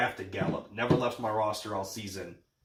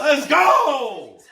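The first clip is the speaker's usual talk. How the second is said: high, loud laughing cheer; hype shout; 13 dB louder than usual. Real, not acted. Celebrating, laughing.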